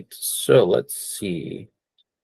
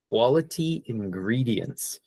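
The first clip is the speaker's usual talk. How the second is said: slow model phrase; 6 dB quieter than usual. Shown as a demonstration. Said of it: "two words that are very good".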